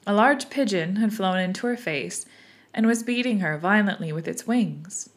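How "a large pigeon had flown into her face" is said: plain reading voice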